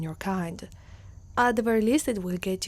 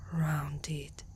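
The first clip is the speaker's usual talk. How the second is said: lower, much softer whispered word; awed whisper; 4 semitones lower, 7 dB quieter than usual. Acted. Centaur marvels at the human's ears, hushed awe. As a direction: let the word fall to a hush.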